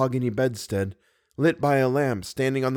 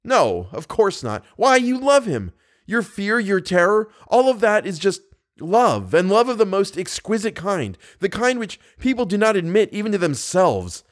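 high, loud, jealous accusation; agitated, scornful lover's tirade; bitter; 7 semitones higher, 3 dB louder than usual. A narrator's reading. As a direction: hurl it at her bitterly. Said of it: "said Raoul bitterly".

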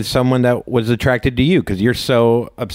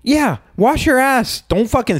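emphatic; 10 semitones higher than usual. Real, not acted. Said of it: "before I blow you".

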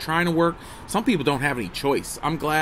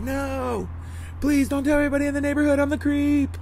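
mock pleading; 12 semitones higher than usual. Dad begging a passing neighbor not to think him creepy, mock pleading. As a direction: beg in a silly high wail.

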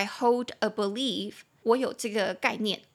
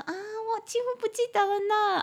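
much higher, sweet coy dodge; coy polite dodge; 11 semitones higher than usual. Retold or imitated. Teacher acting a polite speaker avoiding saying no memory, coy and high. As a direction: say it sweetly to soften it. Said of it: "所以你就可能就说".

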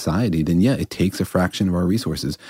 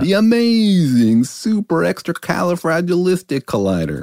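grand announcer voice; 12 semitones higher than usual. Pretend. Joking about grandiose collider names.

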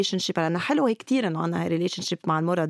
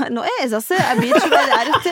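fast, louder, laughing; 8 semitones higher, 6 dB louder than usual. Real, not acted. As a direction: blurts it out laughing. Laughing about patients giving birth. (بضحك وانفعال)

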